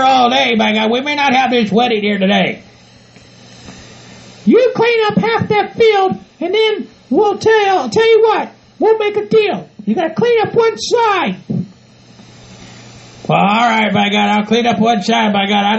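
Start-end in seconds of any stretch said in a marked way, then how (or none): 4.4-11.4 high, slow falsetto bargaining; bossy partner. Acted. Bride offering groom a deal on cleanup, bossy. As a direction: set terms in a high put-on voice.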